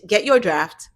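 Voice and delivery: usual speaking voice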